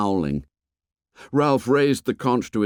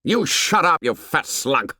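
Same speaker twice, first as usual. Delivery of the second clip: angry; 6 semitones higher than usual. Acted. Jack turns on Piggy.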